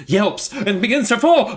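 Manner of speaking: high, excited climax; hammed-up rising alarm; mock-dramatic narration. A narrator's reading. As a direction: raise the pitch for suspense. Dramatic narration of falling cat.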